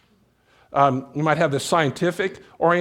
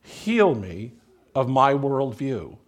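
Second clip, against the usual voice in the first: prayer; slower than usual. Shown as a demonstration. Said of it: "One of the prayers you can pray is".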